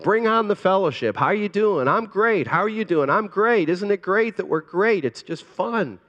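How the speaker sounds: high, bubbly fake-happy small talk; mock cheery chatter. Pastor mocking church small talk, high and cheery. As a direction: play the breezy churchgoer who's doing great. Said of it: "We're just like".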